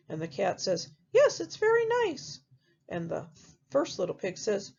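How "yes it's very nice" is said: cat voice pitched way up, pleased; a sweet high cat admiring the castle; the cat praising the castle, pitched high. Acted.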